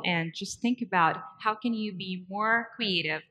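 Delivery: ordinary delivery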